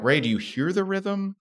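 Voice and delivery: high-pitched mock instructor asking; imitating the dance teacher. Dance teacher quizzing a clumsy Ray, high mock voice. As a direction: parody an exasperated ballroom coach. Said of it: "They're like".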